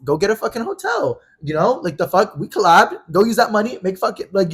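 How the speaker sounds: high, loud, heated outburst; retold angry rant. Telling a friend to go get a hotel, angry. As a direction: re-enact telling someone off, pitch climbing. Acted quarrel, ordering friend out.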